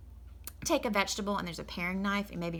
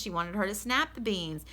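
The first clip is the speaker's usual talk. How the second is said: ordinary delivery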